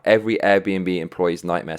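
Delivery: normal talking voice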